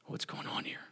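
hushed aside to a companion; puzzled whisper. Acted. Baffled disciple reacts to the teaching, puzzled whisper. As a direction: whisper it, very soft.

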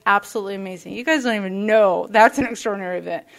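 ordinary talk, nothing marked

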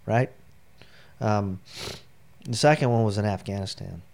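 usual speaking voice

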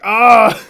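loud, high mock "ah"; imitated yell. Fan acting out the cutscene's impact, loud yell. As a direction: burst out with a hammed-up yell.

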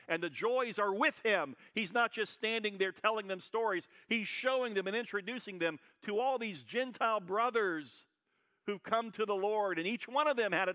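full-throated pulpit preaching; preaching at full force. Acted. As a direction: preach it out loud to the whole room.

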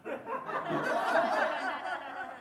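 high, long imitated giggle; Baba's laugh. Teacher imitating Baba for his listeners, high giggle. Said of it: "Baba went like this".

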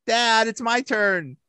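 high, loud pleading child impression; impatient kid. Host voices commentator's kid outside porta potty, impatient child. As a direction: whine for a turn, drawn out at the end. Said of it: "his kid went in next".

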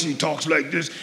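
lightly voiced raspy big preacher; a hint of a burly man's rasp; raspy voice impression. Preacher imitating Doctor Ford for the congregation, light rasp. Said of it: "he's got this real raspy voice".

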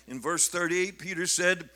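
ordinary talk

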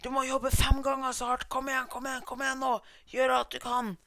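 high, loud mock yell at the bricklayer; shouting. Boss yelling at the bricklayer to work five times harder, loud shout. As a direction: bark orders like a pushy foreman. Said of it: "å skrike til han murmannen".

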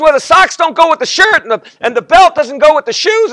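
a wife listing what doesn't go together; wife's voice. Retold or imitated. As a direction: explain the mismatch like the wife. Wife explains clothing mismatch.

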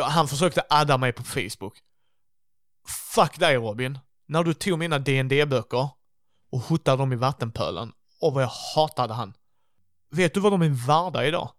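loud, indignant outburst; angry rant. Acted. Player rants about Robin trashing his books, angry. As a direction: rail at an old bully.

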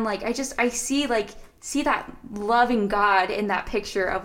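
ordinary talk

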